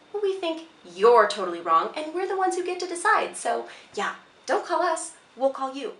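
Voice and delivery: employer's retort, jumping high and loud; emphatic rejection. Acted. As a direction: shut the applicant down with a high, punchy comeback. Acted employer firmly rejects the applicant.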